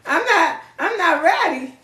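hammed-up scared voice backing off; mock panic. Ghoster voiced by the reader, mock panicking. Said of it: "They were like".

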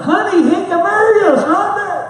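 high, loud, laughing tease; laughing joke. Retold or imitated. Biker lady laughing to her partner, high and loud.

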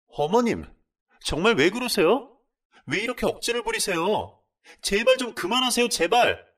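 desperate shouted plea; shouting back. Acted. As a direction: beg her to stop at full voice. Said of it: "소리를 질렀는데".

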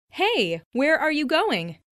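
ordinary delivery

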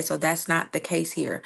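ordinary delivery

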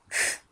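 F as voiceless friction, "fff"; letter sound demo. Shown as a demonstration. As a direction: sound out F with no vowel. (F'yi "fff" diye üfle)

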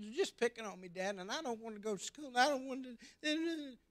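put-on high, tearful child's plea; crying child. Hurt boy begging his dad to stay home, tearful. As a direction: plead like a little boy holding back tears. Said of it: "started to cry".

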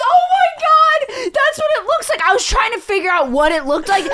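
very high, loud, thrilled shrieking; bursting with delight at a perfect comparison; excited. Real, not acted.

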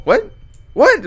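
shocked high shout; startled yelp. Real, not acted. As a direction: yelp it in surprise. Sudden scare in game.

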